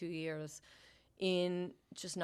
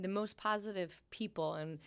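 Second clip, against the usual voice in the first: usual speaking voice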